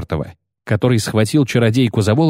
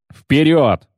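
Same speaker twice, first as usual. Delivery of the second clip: rallying shout; 4 semitones higher than usual. A narrator's reading. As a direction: call the troops forward. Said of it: "крикнул он".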